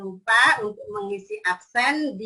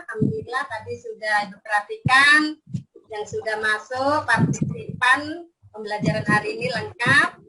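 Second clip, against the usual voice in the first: kindergarten teacher voice; 4 semitones higher than usual. Acted. Teacher checking class connection.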